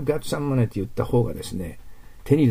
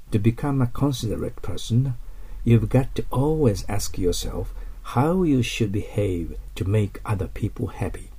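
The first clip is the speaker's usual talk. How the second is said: slow, careful exam-style reading; listening passage read aloud; slower than usual. Shown as a demonstration. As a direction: read it out slowly for a dictation. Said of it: "目つぶってください".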